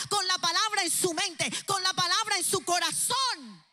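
high, loud, building preacher's cry; full-force preaching. Acted. Pastor preaching to parents about their young people, fervent and loud. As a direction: pour it out over the young people.

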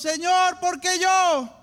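loud, high, drawn-out plea; anguished cry to God. Acted. Acted desperate cry to God.

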